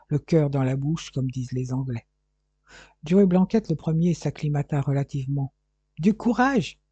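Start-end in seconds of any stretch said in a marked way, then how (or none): 6.0-6.8 lightly acted cheer-up call; encouraging cry. A narrator's reading. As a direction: cry out to rally a companion. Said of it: "cria-t-il à Corfe".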